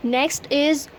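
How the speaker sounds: ordinary talk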